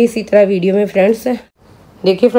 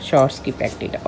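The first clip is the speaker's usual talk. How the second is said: whispered echo; 5 semitones lower, 7 dB quieter than usual. Real, not acted. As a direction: breathe it back in a whisper. Mother echoes child's words.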